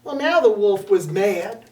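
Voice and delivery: ordinary delivery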